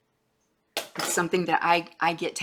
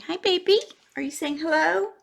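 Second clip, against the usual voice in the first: high-pitched, cooing talk to a pet; 11 semitones higher, 4 dB louder than usual. Real, not acted. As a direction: greet your pet in a high sing-song. Baby-talk to pet Gracie.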